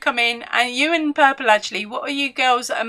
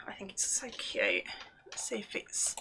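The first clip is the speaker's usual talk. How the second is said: whisper; 2 semitones lower, 14 dB quieter than usual. Real, not acted. Quiet aside about her blanket.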